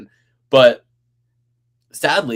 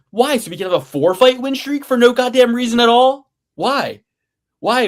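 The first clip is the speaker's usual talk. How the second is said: exasperated rant; 10 semitones higher, 5 dB louder than usual. Real, not acted. Ranting about unfair matchups.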